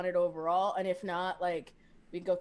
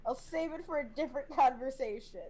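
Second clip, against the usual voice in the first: confused; 5 semitones higher than usual. Acted. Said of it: "Trip will just look at confusion".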